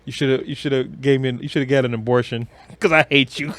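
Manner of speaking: ordinary delivery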